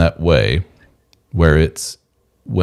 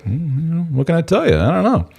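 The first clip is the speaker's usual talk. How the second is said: coy husband; 9 semitones higher than usual. Retold or imitated. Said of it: "And he's like".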